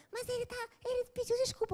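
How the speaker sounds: put-on high-pitched pleading girl's voice; pleading friend. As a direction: whine it up high in her defence. Friend defends boyfriend.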